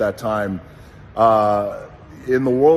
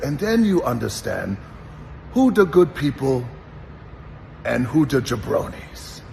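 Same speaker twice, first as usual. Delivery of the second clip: put-on Iranian accent landing the punchline on jabronis; a fond Iranian-accented warning about jabronis; Iron Sheik accent; 3 semitones higher than usual. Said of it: "he had this great accent".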